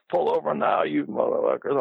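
mock trucker-road rage, high and pushy; Doctor Death yelling. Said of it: "you know how he talks".

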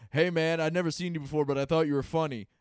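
loud southern guy, imitated at full volume; full-volume heckler. A fan barging in on a comic who is taping, full-volume imitation. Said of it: "comes over in full volume".

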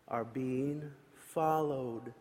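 slow soft and low; slow quiet menace; ominous. Acted. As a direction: let it creep out slowly.

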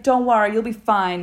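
mock reassuring nurse. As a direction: brush off her worry lightly. Nurse reassuring, voiced in story.

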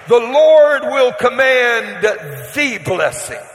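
slow, drawn-out, booming proclamation. Real, not acted. Preacher declaring God's command to his congregation, slow and booming. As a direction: lay each word down slowly and heavily. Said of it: "I need you to see that".

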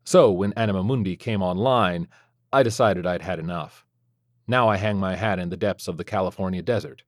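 plain reading voice

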